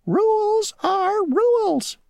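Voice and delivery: very high and slow, squeaky and stubborn; the mosquito refusing the grasshopper, high and slow. Acted. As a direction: stubbornly draw out the rule. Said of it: "said the mosquito".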